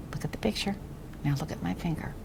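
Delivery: soft whispered instruction; whispering. Real, not acted.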